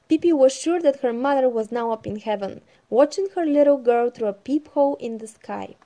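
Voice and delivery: loud, lively storytelling voice; story narration. Acted. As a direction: read it out like a bedtime storybook. Reads the Pippi story aloud.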